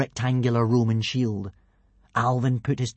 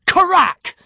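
high, explosive burst on one word; punchy, loud exclamation; sudden loud crack; 9 dB louder than usual. A narrator's reading. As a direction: shout it out like splintering wood. Narrator voices the barrel breaking.

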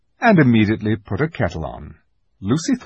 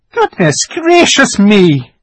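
high, loud, drawn-out startled cry; startled exclamation; 10 semitones higher, 10 dB louder, slower than usual. A narrator's reading. Fawn startled on seeing Lucy, startled exclamation. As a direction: exclaim it, thoroughly taken aback.

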